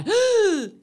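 a sharp frightened high gasp. Shown as a demonstration. Gasps at her own fright. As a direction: suck in air like a scared gasp. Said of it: "When I'm scared, I".

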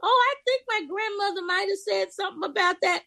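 high, fast, half-remembering student voice; surprised student. Retold or imitated.

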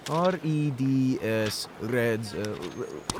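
normal talking voice

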